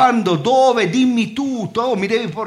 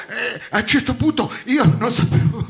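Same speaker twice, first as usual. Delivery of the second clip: ordinary talk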